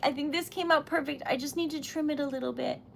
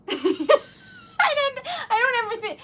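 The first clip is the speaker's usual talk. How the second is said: laughing; 9 semitones higher, 6 dB louder, slower than usual. Real, not acted. Laughing while overwhelmed.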